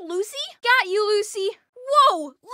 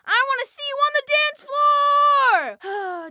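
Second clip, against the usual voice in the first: loud high slow hype shout; party shout; 5 semitones higher, 5 dB louder than usual. Acted. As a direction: call the crowd to the floor. Hyping party people onto dance floor.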